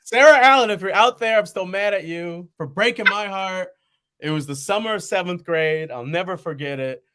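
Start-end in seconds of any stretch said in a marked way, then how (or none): 0.0-3.7 calling out his ex by name, loud and high; mock call-out. Man calling out the girl who broke his heart, loud mock outrage. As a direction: yell her name across the years in mock outrage.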